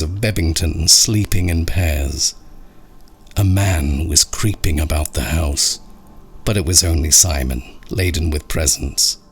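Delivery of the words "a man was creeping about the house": ordinary delivery, nothing marked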